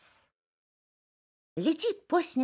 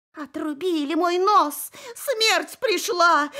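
higher, louder, slow panicked cry; terrified gasp; 9 semitones higher, 7 dB louder, slower than usual. Acted. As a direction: fox howling in terror. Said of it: "ахнула лиса".

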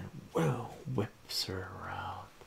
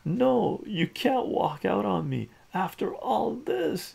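high, loud frantic begging; pleading cry; 12 semitones higher, 5 dB louder than usual. Acted. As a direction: beg her not to leave. Ataru begs girlfriend to stay.

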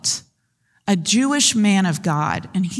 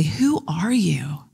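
hushed question; 3 semitones lower, slower than usual. Acted. Sailors confront Jonah after lot.

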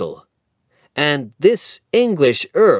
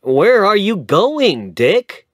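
surprised call; 11 semitones higher, 7 dB louder than usual. A narrator's reading. Lawyer calls after Dick.

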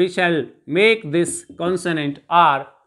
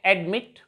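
Admit with the stress on its last syllable, मिट; stress on second syllable; 5 dB quieter than usual. Shown as a demonstration. Teacher showing where the accent falls, admit stressed on मिट. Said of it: "इसको थोड़ा ऊंचा बोलते हैं".

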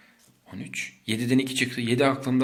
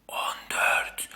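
whisper. Shown as a demonstration.

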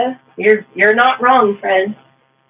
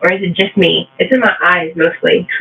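ordinary talk

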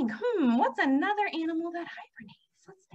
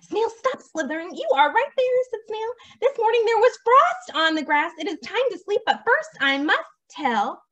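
lively, sing-song storybook delivery; animated picture-book narration; story reading to children; 5 semitones higher, 6 dB louder than usual. A narrator's reading. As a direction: read it to little ones with big pitch swings.

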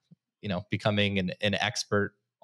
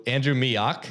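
ordinary talk, nothing marked

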